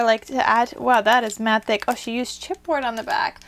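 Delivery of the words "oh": usual speaking voice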